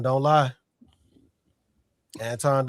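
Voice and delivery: ordinary delivery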